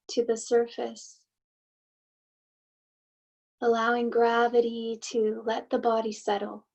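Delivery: unhurried meditation guide, long pauses between phrases; guided meditation. Acted. Host leading listeners to soften and settle, guided meditation. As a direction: gently guide listeners inward, leaving space after each thought.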